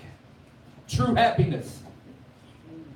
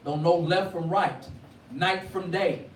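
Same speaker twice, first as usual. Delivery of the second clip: preaching; 4 semitones higher than usual. Acted. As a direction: build the sermon to a ringing peak. Preacher building to full force.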